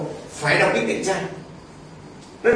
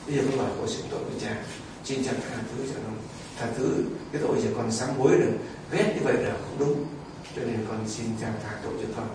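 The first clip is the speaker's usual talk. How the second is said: softer, meek plea for pardon; humble plea; 8 semitones lower, 8 dB quieter than usual. Retold or imitated. Penitent asking the priest for absolution, softly. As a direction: lower the voice and beg humbly.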